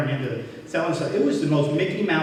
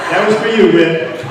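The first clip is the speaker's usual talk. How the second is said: laughing; 5 semitones higher, 10 dB louder than usual. Real, not acted. Laughing aside to friend.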